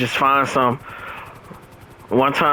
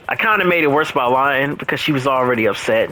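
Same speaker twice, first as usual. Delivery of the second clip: ordinary talk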